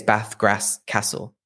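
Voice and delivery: "Bath, Grass, Castle" with the A of "cat"; short flat vowels in "Bath, Grass, Castle"; northern short A. Shown as a demonstration. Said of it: "everybody north from that town".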